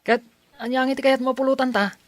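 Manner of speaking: high, quick and loud excited question; eager offer. Acted. Friend offering to buy snacks, eager and high. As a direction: bounce it out, eager to treat him.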